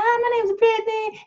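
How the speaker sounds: put-on light, girlish Southern accent hello; cute Southern accent. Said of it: "this really cute Southern accent".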